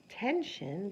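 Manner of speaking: normal talking voice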